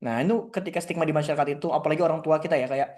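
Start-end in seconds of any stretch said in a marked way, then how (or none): none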